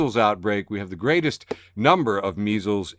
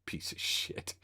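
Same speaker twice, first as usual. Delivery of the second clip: breathy whispered curse under the breath; whispered insult; 17 dB quieter, slower than usual. Real, not acted. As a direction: hiss it under your breath.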